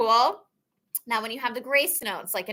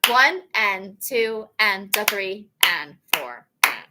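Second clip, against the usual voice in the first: slow, deliberate clap-count; teacher leading a slow count; slow counting demo; slower than usual. Shown as a demonstration. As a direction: count each beat slowly and clearly. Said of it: "clap and count".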